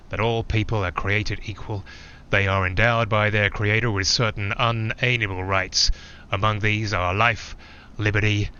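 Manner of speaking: a stately, declaiming reading; grand formal recital. Acted. Reciting the Declaration to the listener in a formal, declaiming voice. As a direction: proclaim it like a founding document.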